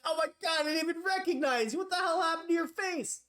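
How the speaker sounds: high, excited gush; astonished recognition. Acted. Acts recognising long-lost sibling.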